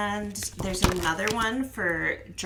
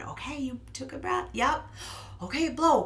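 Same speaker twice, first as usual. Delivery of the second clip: encouraging prompt; 7 semitones higher than usual. Shown as a demonstration. Prompting child in demo.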